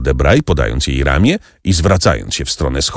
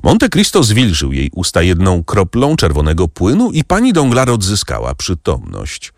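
usual speaking voice

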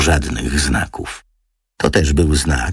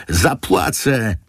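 higher, slow desperate mild yell; 8 semitones higher, slower than usual. A narrator's reading. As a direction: blurt the promise in desperation. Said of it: "wrzasnęła Antonina".

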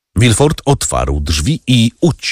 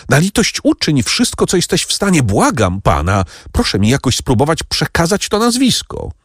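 put-on high, pleading young man; desperate begging; 8 semitones higher than usual. A narrator's reading. Franz begs Noirtier, desperately pleading. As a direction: beg the old man for the name.

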